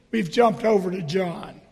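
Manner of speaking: ordinary delivery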